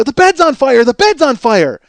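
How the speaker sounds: high, loud repeated warning; retold panicked shouting; shouted alarm. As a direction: yell to wake a sleeper, urgently. Waking brother to warn him.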